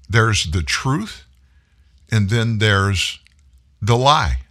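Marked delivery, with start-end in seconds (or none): none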